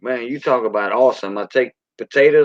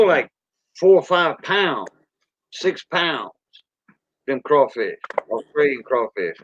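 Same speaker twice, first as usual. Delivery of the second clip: ordinary talk, nothing marked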